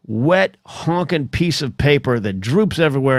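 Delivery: ordinary talk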